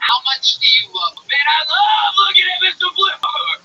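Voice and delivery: loud, high, hammed-up fan yelling; mock hype shout. Comic interview, shouting his love for Flipper. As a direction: yell it like an overexcited superfan.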